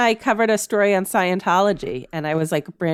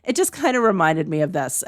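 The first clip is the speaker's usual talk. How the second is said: usual speaking voice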